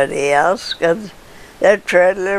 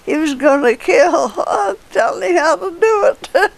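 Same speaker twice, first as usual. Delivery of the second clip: laughing while talking; 12 semitones higher than usual. Real, not acted. Laughing at her own story.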